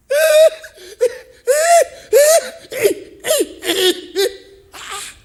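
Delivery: big high cackling laugh from the pulpit; loud laugh. Real, not acted. Preacher laughing hard at his own joke about apologies, loud and high. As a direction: throw back your head and laugh out loud.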